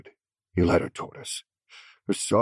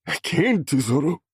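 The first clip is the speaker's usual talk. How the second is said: sobbing refusal; 10 semitones higher, 4 dB louder than usual. A narrator's reading. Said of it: "sobbing as he tried to speak".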